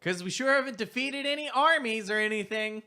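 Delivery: high, loud sarcastic quip; loud comic sarcasm. Acted. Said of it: "speaks up a little loudly, almost comically".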